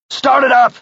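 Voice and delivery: loud urgent shout, pitched high; yelling. Acted. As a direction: yell it to get the truck going. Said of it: "Nick yelled".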